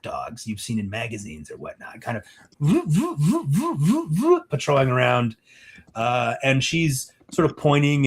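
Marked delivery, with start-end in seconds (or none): 2.6-5.4 rising and falling robot whirr; repeated motor vrooms, swooping high; imitated motor whirr. Game master sounding out a patrolling robot dog, motor whirr.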